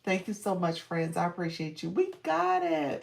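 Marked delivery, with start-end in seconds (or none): 1.9-3.0 high, drawn-out victory cheer; real triumphant whoop, stretched out; celebrating. Real, not acted. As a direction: call it out loud and long, goal reached. Celebrates reaching the flash goal.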